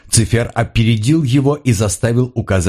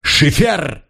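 a high yell of the partner's name; Paul yells at Schiffer over the screaming: high yell; shouted name; 7 semitones higher than usual. A narrator's reading. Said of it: "вопль Поля".

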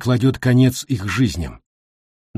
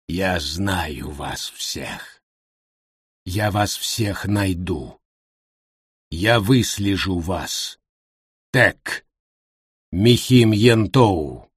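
low, slow creaky demon voice; creaky inhuman voice; 4 semitones lower, much slower than usual. Acted. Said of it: "скрипучим, нечеловеческим голосом".

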